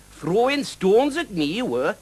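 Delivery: high, loud, slow dragon voice; accusing dragon. A narrator's reading. Dragon accuses the knight of throwing stones, accusing.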